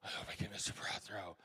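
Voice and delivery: soft breathy whisper of a wish; hopeful whisper. Retold or imitated. Kid confiding to his mom, hopeful whisper. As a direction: whisper it like a secret hope. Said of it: "And I told my mom".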